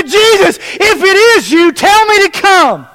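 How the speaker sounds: high-pitched acted call to Jesus. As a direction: call urgently across the water, acting a disciple. Acting Peter calling to Jesus.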